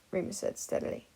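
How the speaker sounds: ordinary delivery, nothing marked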